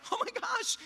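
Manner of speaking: high amazed oh my gosh; amazed gasp. Retold or imitated. Realizing his life changed, high amazement. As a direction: lift it up in wonder.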